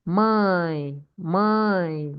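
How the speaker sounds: 'mãe' drawn out on the nasal vowel; stressed vowel. Shown as a demonstration. Teacher showing class the stressed sound, long 'mãe'. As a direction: hold the vowel of 'Mãe', then let fall. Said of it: "is stressed".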